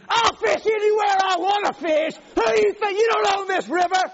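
high, full-voiced furious shout; loud, indignant holler at top of voice; angry yelling. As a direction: yell defiantly after a departing rival. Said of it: "I'm yelling at him".

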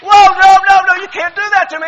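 yelling at the TV. Retold or imitated. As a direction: shout back at the preacher on TV. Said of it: "I'm yelling at the television".

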